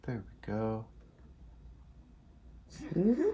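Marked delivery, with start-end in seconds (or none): 2.9-3.3 high-pitched little squeal of "whee"; quick, soaring whee without shouting; playful whee. Real, not acted. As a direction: let out a high, gleeful whee. Playful cry during the game.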